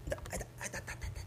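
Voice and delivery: whispered groans of pain. Acted. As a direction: breathe out the hurt, barely voiced. Sudden stomach pain.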